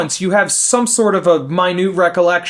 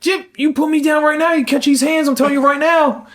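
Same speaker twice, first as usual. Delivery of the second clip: put-on toddler fury; 9 semitones higher than usual. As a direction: shout it like an enraged little kid. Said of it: "giving it her all".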